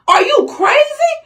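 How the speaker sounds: high, crying-out outrage; real upset, voice cracking high; outraged cry. Real, not acted. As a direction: cry it out at the shooters. Outraged at shooters.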